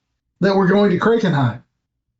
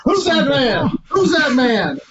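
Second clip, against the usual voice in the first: laughing mock challenge; 6 semitones higher than usual. Player jokingly voices guard, laughing.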